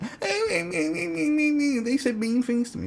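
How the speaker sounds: very high falsetto bleating; squeaky, babyish mockery of complaining; mock whining. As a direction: bleat like a hammed-up victim. Mocks people whining after backlash.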